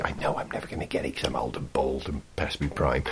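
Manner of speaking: a rueful self-pitying whisper; whispered aside. Real, not acted. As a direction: whisper it under your breath.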